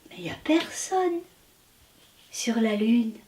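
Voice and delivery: soft unvoiced whisper. Wasabu alone on the Moon, whispering softly. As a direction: breathe it out in a hush.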